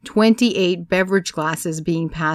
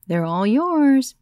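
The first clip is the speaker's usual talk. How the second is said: slow, higher handover; slow sweet handover; 7 semitones higher, much slower than usual. A narrator's reading. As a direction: give the room away with calm. Gilly repeats, slow and sweet.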